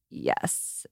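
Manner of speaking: breathy whispered triumphant yes. Retold or imitated. Mom whispers to herself as the salesman apologises, secret yes. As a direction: whisper a private victory yes.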